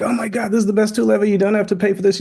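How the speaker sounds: high, fast, thrilled rush of words; excited gushing. Retold or imitated. Hosts retelling their early hype to the client, excited. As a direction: gush like a fan who just found the best tool. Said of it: "We were like".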